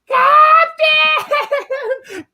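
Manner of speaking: very high, loud wail; hammed-up shrieking cry; mock screaming. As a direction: scream for help like a panicked child. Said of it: "And he's screaming".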